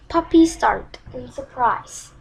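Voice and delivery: usual speaking voice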